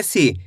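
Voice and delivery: सी dropped low on a brief vowel; short i vowel. Shown as a demonstration. Teacher repeating the short i demo, brief सी. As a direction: stretch सी only a little. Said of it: "इतना ही खींच के पढ़ेंगे".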